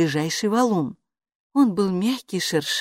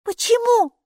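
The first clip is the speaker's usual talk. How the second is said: put-on high, curious girl asking; girl's voice; 5 dB louder than usual. A narrator's reading. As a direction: ask back innocently. Child echoes question. (детский вопрос)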